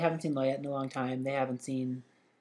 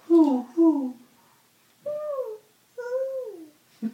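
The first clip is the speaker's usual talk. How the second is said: high hooting owl; 6 dB louder, much slower than usual. Acted. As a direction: hoot high like a little owl. Owl sound for the baby.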